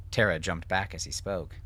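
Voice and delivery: usual speaking voice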